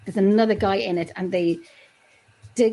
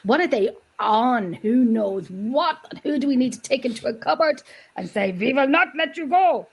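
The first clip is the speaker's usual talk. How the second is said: slow, drawn-out mock German accent; hammed-up German interrogator; bad German accent; 2 semitones higher than usual. Joking with friends about Simpsons predictions, bad German accent. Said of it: "That was my really bad German accent".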